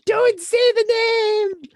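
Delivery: high, slow, far-off shriek of panic; hammed-up high panicked cry; mock shriek. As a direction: squeal it as you run. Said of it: "I shouldn't do your accent".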